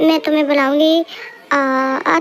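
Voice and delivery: ordinary talk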